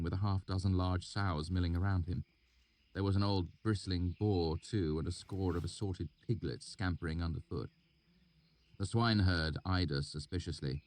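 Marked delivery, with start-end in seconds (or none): none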